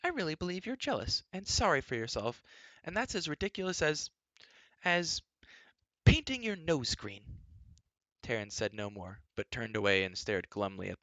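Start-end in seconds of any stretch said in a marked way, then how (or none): 0.0-7.2 high, exasperated princess, slowing on the last phrase; princess scolding. A narrator's reading. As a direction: scold him in a high girlish voice.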